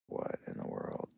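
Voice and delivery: hushed, breathless disbelief; baffled whisper. Retold or imitated. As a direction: whisper it as if stunned by the tracks. Baffled at strange tracks.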